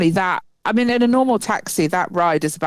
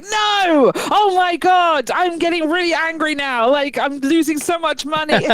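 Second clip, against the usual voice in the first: higher, hammed-up mock anger; mock outrage; 8 semitones higher than usual. Driver hams up losing money to the girl in a quiz, mock outraged. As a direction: pretend fury over losing money.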